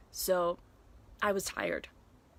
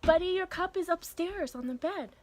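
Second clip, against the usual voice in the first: high, lilting mommy voice; 10 semitones higher, 4 dB louder than usual. Real, not acted. Mother directing her child to a cup, high parent voice. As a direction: pitch it right up for a little one.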